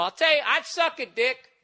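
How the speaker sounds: put-on high, stretched-out drunken declaration; mocked drunk guy. Drunk guy boasting to the whole bus, mocking imitation. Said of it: "He just kept going".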